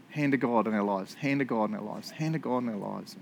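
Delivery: low, soft repeated refrain; hushed reflection. Real, not acted. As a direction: let it sink in quietly, almost to yourself. Awed refrain closing the point.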